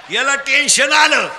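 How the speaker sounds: loud, projected storytelling to a crowd; full-force comic preaching. Real, not acted. Preacher telling children of a man's downfall, full-force comic preaching. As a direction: drive the tale up to an exclaimed punchline.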